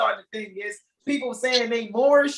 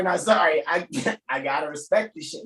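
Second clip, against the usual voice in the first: ordinary talk, nothing marked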